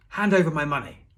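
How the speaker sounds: loud, high shout; shouted demand. A narrator's reading. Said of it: "rush straight up to the man and shout".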